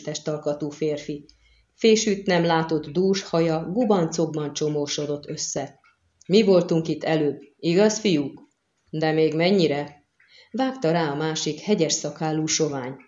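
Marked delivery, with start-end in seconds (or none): none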